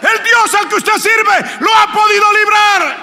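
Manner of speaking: loud, high shouted proclamation; full force preaching. Real, not acted. Preacher shouting God's deliverance to the congregation, full force. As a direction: preach it with all your might.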